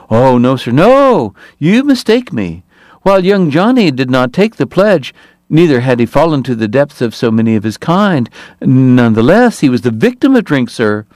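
oily politician in a piping voice; smooth high voice. Acted. Hoover's high voice continues.

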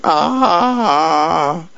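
long, high mock scream at storytelling volume; cartoon child's drawn-out "aah". A narrator's reading. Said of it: "here comes Zoe running crazy out, going".

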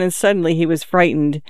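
ordinary delivery

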